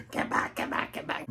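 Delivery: hissed, unvoiced get back. Older feminist shoving girls back, hissed under breath. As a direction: rasp it out under your breath.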